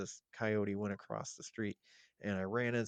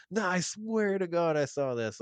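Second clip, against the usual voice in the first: louder, higher, pleading to be believed; insistent retold protest; 9 semitones higher, 8 dB louder than usual. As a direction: swear it's true, pushing hard over her doubt.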